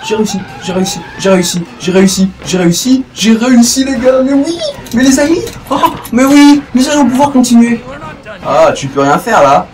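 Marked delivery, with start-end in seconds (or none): 0.0-7.8 excited celebration. Real, not acted. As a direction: cheer your own success. Celebrating success. (joie excitée)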